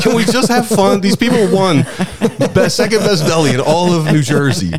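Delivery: high, laughing, pleading; laughing plea. Retold or imitated.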